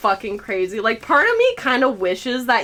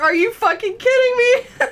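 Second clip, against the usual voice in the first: mock screaming; 11 semitones higher than usual. Retelling yelling at buggy Gale, laughing mock scream. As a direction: shriek it out half laughing. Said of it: "screaming at the top of our lungs".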